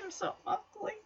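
very soft, high, pitiful murmur; small mock-forlorn voice; sad. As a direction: imitate a crushed teenager, barely audible. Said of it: "he just looks so sad, like".

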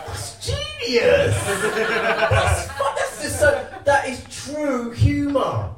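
high, drawn-out cry of awe; hammed-up delighted amazement; loud admiration. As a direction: exclaim it loud and high, overwhelmed. Exclaims in admiration of nephew's line.

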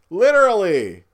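excited emphasis. Real, not acted. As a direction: land it with real excitement. Excited rant about millions of parents.

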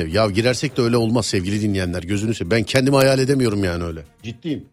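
ordinary delivery

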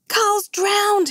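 high, urgent outcry; crying out in alarm. A narrator's reading. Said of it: "he cried".

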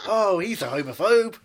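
mock outrage.

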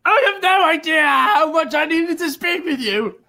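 overjoyed shout. Acted. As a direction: pour it out with joy. Reggie overjoyed reunion.

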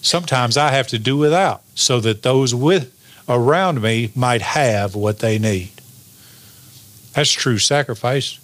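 usual speaking voice

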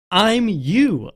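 a hint of the character spelling it out. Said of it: "I love the way he says".

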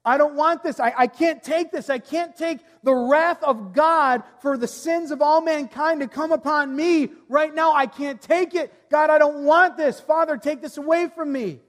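high, loud, desperate pleading; anguished crying out. Acted. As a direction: beg to be spared, voice straining high. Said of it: "Jesus' flesh is crying out".

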